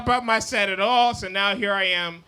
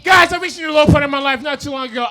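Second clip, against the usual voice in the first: loud, fast restart of the line; louder restart; 4 semitones higher, 7 dB louder, faster than usual. Real, not acted. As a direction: rush it again louder. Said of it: "that's not the low point yet".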